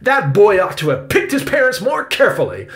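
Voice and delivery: high, loud, haughty indignation; persisting loudly. A narrator's reading. Victoria blames the boy to Salvador, loud indignation. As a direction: deliver a loud, cutting last word. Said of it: "Victoria persisted loudly".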